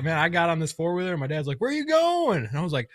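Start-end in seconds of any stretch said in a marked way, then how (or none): 1.6-2.4 high-pitched call after someone leaving; mock dad calling out, pitched up; retold calling out. Dad calling after fleeing son.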